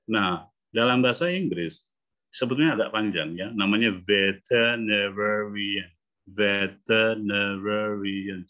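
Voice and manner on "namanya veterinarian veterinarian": "veterinarian" drawn out slowly, all six syllables clear. Shown as a demonstration. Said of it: "ada enam suku kata". ("Veterinarian" diucapkan pelan per suku kata)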